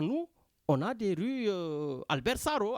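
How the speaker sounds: normal talking voice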